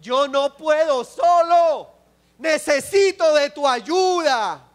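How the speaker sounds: a believer begging the Lord, loud and earnest; pleading prayer. Acted. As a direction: cry out to the Lord for help. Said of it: "Es de decirle al Señor".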